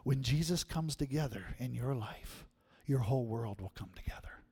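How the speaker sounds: soft, hushed grandma, nearly whispering; wise grandma. Retold or imitated. Grandma giving the child her lesson, hushed and soft. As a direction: share the lesson in a tender hush. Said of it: "in all of her wisdom, said to her".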